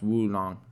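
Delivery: "Woolong", softly, starting on a W; W-pronunciation of oolong. Shown as a demonstration. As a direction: quietly correct with "Woolong", W at the front. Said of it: "It's pronounced Woolong".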